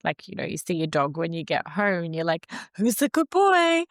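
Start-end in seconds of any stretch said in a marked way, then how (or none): 2.8-3.8 put-on high, loud baby talk to a dog; sing-song voice for a good boy; sing-songy pet talk. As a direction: coo at the dog coming home. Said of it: "that sing-songy voice".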